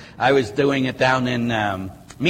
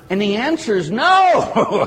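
laughing; 7 semitones higher, 4 dB louder, slower than usual. Real, not acted. Laughing at priests' own disbelief.

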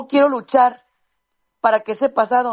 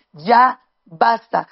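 slow, louder, each word weighed; firm "enough"; 4 dB louder, much slower than usual. Real, not acted. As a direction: put your foot down, slowly. Exhorta a los padres. ("Ya basta" lento y más fuerte, con firmeza)

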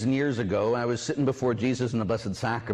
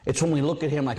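usual speaking voice

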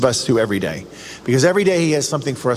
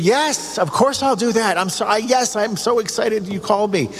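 much higher-pitched eager yes; excited; 7 semitones higher than usual. Pretend. Parishioner accepting a task from the Pope, excited. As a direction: act out gushing, excited acceptance. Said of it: "that's how excited you'd be".

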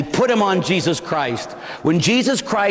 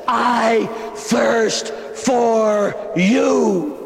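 drawn out very slowly; I thirst for you, very slow; slow longing plea; 4 semitones higher, much slower than usual. Acted. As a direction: stretch every word with longing. Jesus speaking to listener.

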